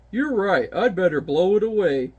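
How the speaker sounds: ordinary talk, nothing marked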